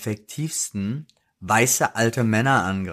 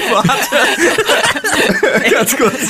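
much higher, louder, laughing hard; laughing fit; 12 semitones higher, 5 dB louder, much slower than usual. Real, not acted. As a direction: gasp it out through real laughter. Laughing fit, begs pause. (unter Lachen gepresst, viel höher)

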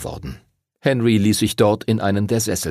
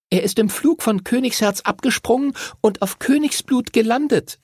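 put-on much higher girl's voice; girl chiming in; 13 semitones higher than usual. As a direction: chime in high and lively. Voices Chloe adding excitedly.

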